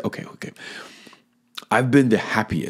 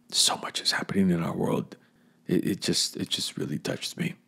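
moved, near tears; 7 dB quieter than usual. Real, not acted. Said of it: "I'm emotional thinking about that".

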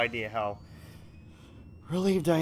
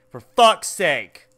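loud curse; 10 dB louder, slower than usual. Real, not acted. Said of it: "For the sake of fuck".

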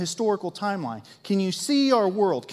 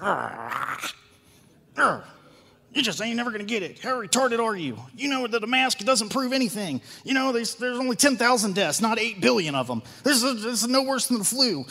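higher, quicker exasperated rant; mock frustrated self; 4 semitones higher than usual. Pastor mimicking himself leaving an argument. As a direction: grumble then rattle off the argument. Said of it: "we usually both leave the table like".

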